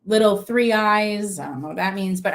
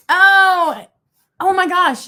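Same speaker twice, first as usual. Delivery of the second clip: ordinary talk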